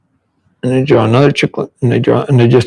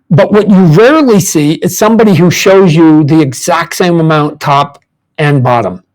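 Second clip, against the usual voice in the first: usual speaking voice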